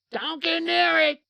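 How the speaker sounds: high, drawn-out holler; yelling lady. Retold or imitated. Woman yelling from the roadside houses, shrill panicked yell. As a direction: scream a warning, stretching it out loud. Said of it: "this one lady just yelling".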